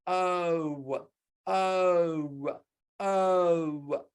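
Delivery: oh with rounded lips; teacher showing rounded lips for oh. Shown as a demonstration. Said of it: "Look at my lips".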